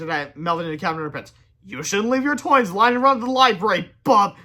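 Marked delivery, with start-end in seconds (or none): 1.6-4.4 high, loud, sly tease. A narrator's reading. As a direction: tease him slyly. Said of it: "George said slyly".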